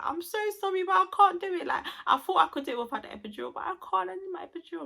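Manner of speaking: high, pleading mock wail; desperate apology, retold. Woman in labour apologizes to mum for giving in, high mock-tearful pleading. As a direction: imitate a frantic, tearful apology. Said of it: "I kept apologizing to her".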